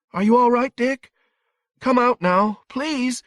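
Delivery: very high, loud, frantic pleading; thin, frantic. A narrator's reading. Frightened woman begs Dick to come out, frantic. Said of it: "The voice was thin, frantic".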